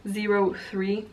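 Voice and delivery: normal talking voice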